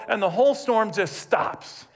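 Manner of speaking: ordinary talk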